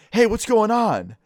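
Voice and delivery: high, drawn-out startled call; retold yell. Scared kid on bus to unknown driver, yelling out. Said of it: "I yell out".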